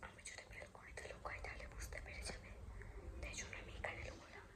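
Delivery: frightened hushed whisper; scared whisper. Real, not acted. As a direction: whisper tensely, afraid of discovery. Hiding in fear, says scared.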